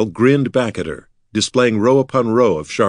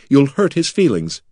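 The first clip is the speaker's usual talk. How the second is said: mildly cross, higher-pitched scolding; cross reproach; 6 semitones higher, 3 dB louder than usual. A narrator's reading. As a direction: reproach him on someone else's behalf. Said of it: "Bekla said crossly".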